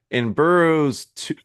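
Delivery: broken by a hiccup. Real, not acted. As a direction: hiccup cuts the words. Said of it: "I keep hiccuping".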